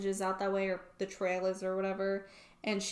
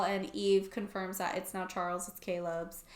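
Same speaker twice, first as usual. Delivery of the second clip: normal talking voice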